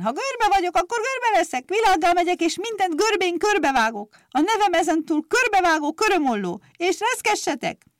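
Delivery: shrill threatening vow, very high. A narrator's reading. Vengeful clippers declaring war, high and loud.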